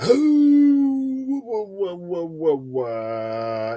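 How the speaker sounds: high ooh, then falling wah wah; mock sad trombone. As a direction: sound off a comic failure jingle. Mocks her own idea after laughing.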